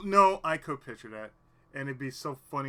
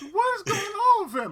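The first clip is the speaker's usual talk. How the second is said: mock baffled viewer; 11 dB louder than usual.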